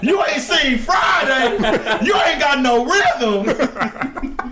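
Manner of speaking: high, slow taunting jeer; mocking heckler voice. Mimicking the grown folks shaming a new spades player, high slow jeer. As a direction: sneer it out like the uncle shaming you at the card table.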